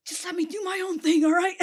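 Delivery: exasperated Catra. Retold or imitated. Catra snapping at Adora.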